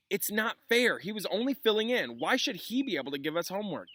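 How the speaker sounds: high, whiny protest; complaining students. Acted. Students complain to Lupin about Snape, whining. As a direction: whine it like outraged kids. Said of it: "explosion of complaints".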